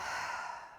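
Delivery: soft, airy sigh of released breath; open-mouthed exhale. Shown as a demonstration. Yoga teacher leads a second breath out, open-mouthed sigh. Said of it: "Open the mouth and exhale".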